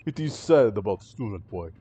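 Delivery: put-on heavy Russian accent, sombre; Russian-accented sailor. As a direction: say it like a Russian crewman. Sailor on the dead student.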